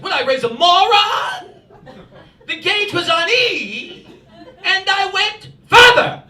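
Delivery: put-on slow, high, loud English-accented mother; mock English-accented tirade, stretched out; angry English accent. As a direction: shriek indignantly in a hammed-up English accent. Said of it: "she does it all in an English accent".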